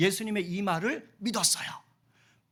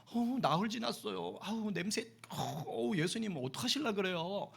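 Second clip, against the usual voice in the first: softer worried Martha; 8 dB quieter than usual. Acted. Martha's polite worried words.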